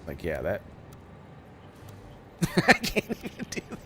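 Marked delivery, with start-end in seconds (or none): 2.4-3.8 laughing. Real, not acted. Laughing at own failed attempt.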